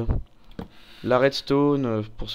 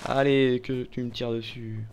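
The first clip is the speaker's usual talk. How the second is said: ordinary talk, nothing marked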